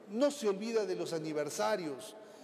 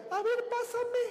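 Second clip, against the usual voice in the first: high nagging wife demanding where he is; jealous wife; 3 dB louder than usual. Retold or imitated. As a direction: grill him like a jealous wife.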